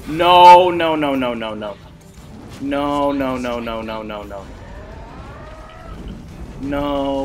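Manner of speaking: descending string of noes; repeated refusal. Real, not acted. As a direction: repeat it while fading and dropping in pitch. Refusing to let enemy escape.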